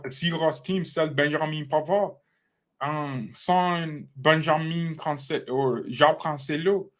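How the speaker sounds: mock French pundit accent; put-on French accent. Streamer comparing Cancelo with Pavard, put-on French accent. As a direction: talk like a Parisian with a heavy French accent. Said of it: "How did you guys like my French accent?".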